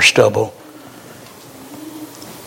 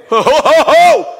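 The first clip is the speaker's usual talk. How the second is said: joyful whoop; 10 semitones higher, 5 dB louder than usual. Pretend. Preacher imagines rapture joy.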